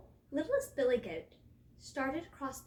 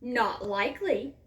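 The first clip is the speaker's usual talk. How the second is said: mildly roared troll refusal; loud, moderately menacing troll; troll's roar; 7 dB louder than usual. A narrator's reading. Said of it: "roared the troll".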